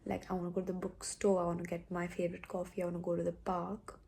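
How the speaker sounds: hushed, not to wake anyone; quiet morning voice. Real, not acted. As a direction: keep it low, everyone's asleep. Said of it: "I can't be loud".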